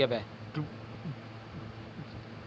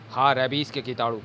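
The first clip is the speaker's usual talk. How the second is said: plain reading voice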